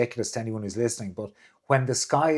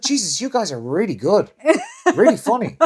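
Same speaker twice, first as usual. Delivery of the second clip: sarcastic retort; 9 semitones higher, 5 dB louder than usual. Retold or imitated. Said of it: "I'm like".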